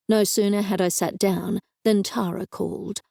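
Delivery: plain reading voice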